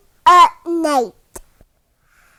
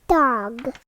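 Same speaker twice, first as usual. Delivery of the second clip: plain reading voice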